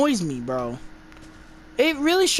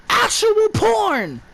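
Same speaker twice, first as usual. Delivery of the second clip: exasperated shout; 5 semitones higher, much slower than usual. Real, not acted. Ranting reviewer fed up with the movie's sex scenes, shouting slowly. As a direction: spell it out, fed up.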